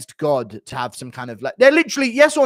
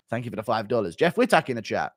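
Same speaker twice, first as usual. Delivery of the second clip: ordinary delivery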